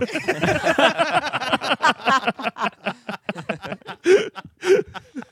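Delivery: high, drawn-out real laughing fit; long laugh. Real, not acted. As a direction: crack up helplessly, high-pitched. Cracks up at own joke.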